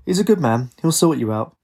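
mumbling through a mouthful; mouth full. A narrator's reading. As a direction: talk round a bite of food. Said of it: "With a mouthful, her father mumbled".

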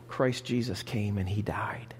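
hushed, breathy whisper of the key truth; whispered sermon aside. Real, not acted. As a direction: drop to a whisper for the cross.

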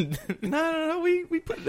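high squeaky protest. Pretend.

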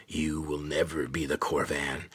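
a lightly voiced lizard snarling low and raspy. A narrator's reading. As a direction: menace the boy with a low, rasping creature voice. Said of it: "it snarled in a low, raspy voice".

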